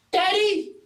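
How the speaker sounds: put-on very high little-kid cry; child calling Daddy. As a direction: call out like a small child. Kids cry out behind the hero.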